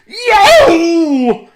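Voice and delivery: very loud high yelp of pain; giant's pained yowl. A narrator's reading. Giant biting an iron pan, loud yowl. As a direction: yelp as teeth hit iron. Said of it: "shouted Cucullin".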